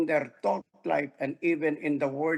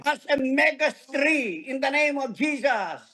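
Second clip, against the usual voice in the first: preaching declaration; 7 semitones higher than usual. Shown as a demonstration. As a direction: press the words home in faith. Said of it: "Come on, say it". (masigasig na pagpapahayag)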